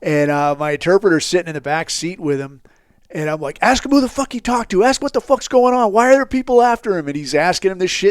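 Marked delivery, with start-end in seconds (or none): 3.6-7.1 raised, urgent commands in a car; urgent shouting. Retold or imitated. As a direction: bark orders over the engine. Yelling orders at interpreter.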